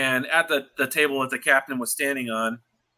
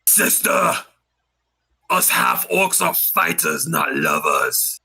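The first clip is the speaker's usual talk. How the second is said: half orc brother; slower than usual. Acted. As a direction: lecture your sister like a gruff half orc. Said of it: "you see Braun just go".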